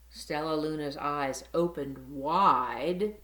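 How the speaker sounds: usual speaking voice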